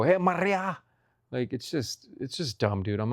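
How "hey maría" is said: high mock Spanish-accented greeting; exaggerated Spanish accent. Greeting a friend named Maria, overdone Spanish accent. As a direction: call it out with a showy Spanish accent. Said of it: "things with the accent".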